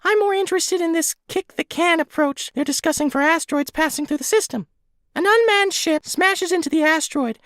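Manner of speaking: very high, squeaky little creature voice; squeaky helium voice. Acted. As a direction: talk like you sucked a helium balloon. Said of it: "in his squeaky helium balloon voice".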